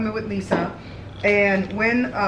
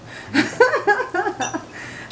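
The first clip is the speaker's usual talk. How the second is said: high giggle; 12 semitones higher than usual. Real, not acted. Laughs at her own joke.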